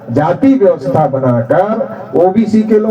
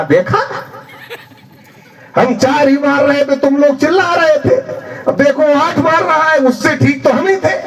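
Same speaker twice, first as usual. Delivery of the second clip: higher, told-you-so gloating; smug ousted politician; 6 semitones higher than usual. Retold or imitated. Ousted politician telling voters he was better, smug told-you-so. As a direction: rub it in like the ex-ruler.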